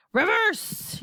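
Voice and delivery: high, urgent yelp; yelling grandma. A narrator's reading. Panicked grandma ordering a turn back, yelled. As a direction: yell the order out, pitch high. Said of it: "yelled Grandma Georgina".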